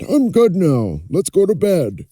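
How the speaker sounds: put-on an old king feeling well again. Old king recovered speaks.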